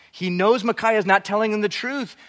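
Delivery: ordinary talk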